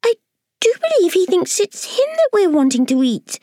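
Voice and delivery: high pitying ladybird wail; ladybird crying out. Acted. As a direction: cry out in pity. Ladybird keeps speaking.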